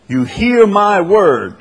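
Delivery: HEAR MY WORD slow, loud and high; emphatic quote. Retold or imitated. Preacher telling disciples what is expected of them, emphatic. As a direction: stretch out HEAR, ringing through MY WORD. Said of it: "the emphasis keeps going back".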